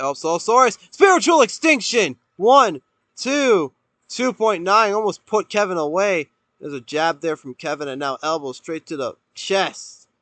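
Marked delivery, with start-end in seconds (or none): 0.0-6.3 loud, high near-fall call; excited commentator. Real, not acted.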